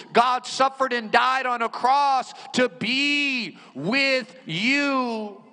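ordinary talk

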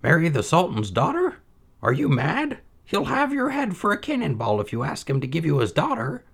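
put-on high old mother's voice, dismissive. A narrator's reading. Mother doubting her son's tale, high and dismissive. Mother appalled by his plan.

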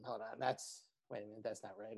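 lightly voiced comic's quiet aside; muttered aside in role. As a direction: mutter it to yourself as Raz. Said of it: "insult roast comic, Raz Clifford".

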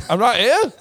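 very high, small, squeaky voice; squeaky little voice. Pretend. Host mimics short Pete, squeaky high voice. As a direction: play a tiny man piping up from below. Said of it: "he'll be like".

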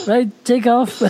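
loud, high command; mock pilot command. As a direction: bark the order to take off. Drone operator voiced.

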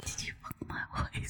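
breathy joking whisper of the same line; mock whisper. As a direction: hiss it like a secret, as a joke. Same joke line repeated.

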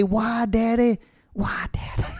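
mock Southern child fading to a high whisper; whining Southern kid. Paul Ryan's imagined kid begging, whispered high whine. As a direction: whimper it, trailing off into a whisper. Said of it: "I'm doing Southern".

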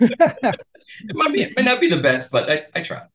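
high, laughing self-deprecation. Real, not acted. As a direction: laugh through the modest words. Self-deprecating laugh after praise.

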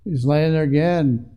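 ordinary delivery

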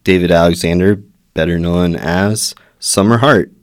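loud, upbeat host welcome, a bit fast; bright announcer-style introduction of the guest; show host intro. Acted. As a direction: open the show like a radio host.

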